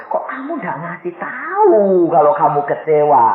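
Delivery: high, mock-tearful wail; suami menangis. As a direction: wail the question like a weeping husband. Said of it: "Suaminya nangis dirangkul". (meratap menirukan suami yang menangis)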